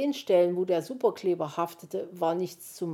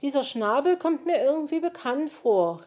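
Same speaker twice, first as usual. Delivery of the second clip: musing aloud, higher and louder; puzzled; 8 semitones higher, 6 dB louder than usual. A narrator's reading. Zwitschi puzzles over a familiar beak, musing. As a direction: wonder out loud in a raised character voice. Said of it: "rätselte Zwitschi".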